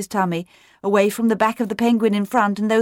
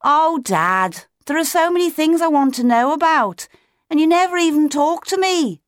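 high, raised chick's protest; shouted plea; 10 semitones higher, 3 dB louder than usual. A narrator's reading. Otto looking up at his father, shouting a complaint. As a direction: shout up at Dad, pitched high. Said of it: "Otto shouted".

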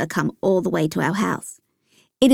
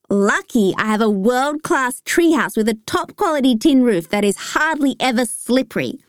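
ordinary delivery

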